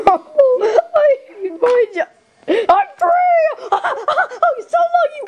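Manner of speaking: very high, hammed-up laughing squeal; hysterical laughing. Ridden like a horse then freed, high hysterical laughter. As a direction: squeal it through wild cackles.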